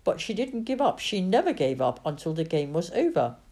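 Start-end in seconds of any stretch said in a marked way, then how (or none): none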